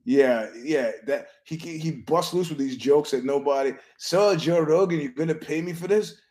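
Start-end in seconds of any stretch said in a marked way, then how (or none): 4.0-6.2 lightly mimicked guest, pitched higher; imitated brash guest. Guest asking Rogan for money, lightly imitated demand. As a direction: pitch it up like a presumptuous interviewee.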